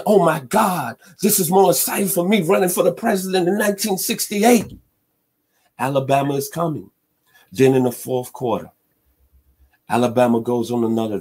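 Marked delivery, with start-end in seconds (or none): 0.0-4.8 high, put-on voice of an excited president; Richard Nixon. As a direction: play him as an excited president, pitched well up. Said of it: "Richard Nixon is saying".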